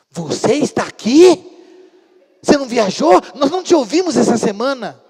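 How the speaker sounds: loud, high, incredulous questions; astonished neighbours. Retold or imitated. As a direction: exclaim in hammed-up amazement as the neighbours. Vizinhos espantados batem na porta. (espanto exagerado, gritado)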